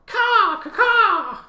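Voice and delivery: loud, high screeching bird cry; eagle war cry. Acted. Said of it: "what would it sound like".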